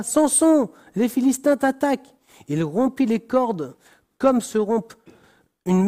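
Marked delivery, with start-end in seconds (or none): 0.0-2.1 cried out. A narrator's reading. Dalila crying out to Samson, high alarmed call. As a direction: shout the warning, pitched up high. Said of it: "elle s'écria".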